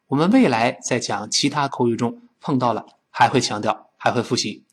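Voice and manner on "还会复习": ordinary talk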